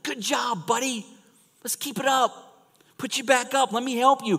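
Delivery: put-on high, gentle cheer for a small child; mock parent cheering a wobbly toddler; encouraging a toddler. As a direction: coax a little kid back up with praise. Cheering on a toddler learning to walk.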